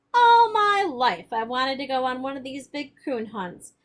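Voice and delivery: almost shout it in excitement; near shout. Acted. Grandpa answering Billy about the coon hunt, almost shouting. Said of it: "and almost shouted".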